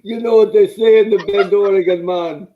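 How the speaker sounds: loud, hammed-up Jamaican lilt; a put-on Jamaican accent, played as the Oregon accent; mock Jamaican accent for Oregon. Cohost asked for his Oregon accent, so booming mock Jamaican accent. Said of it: "do your Oregon accent".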